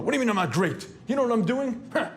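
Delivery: the inner ego talking back, boastful and high; arrogant boasting. Retold or imitated. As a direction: puff up and demand respect. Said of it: "you can almost hear the internal struggle".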